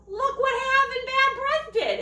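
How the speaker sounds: ordinary delivery, nothing marked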